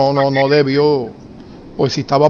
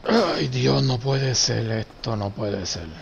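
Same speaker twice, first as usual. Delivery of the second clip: quiet dismay; 4 semitones lower, 10 dB quieter, slower than usual. Real, not acted. Game loss, muttering in disbelief.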